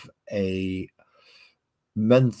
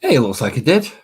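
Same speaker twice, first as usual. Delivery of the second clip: bright, louder burst of delight; a pleased, higher "hey"; pleased exclamation; 5 semitones higher, 9 dB louder than usual. Real, not acted. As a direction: celebrate the paint coming through. Pleased paint went through.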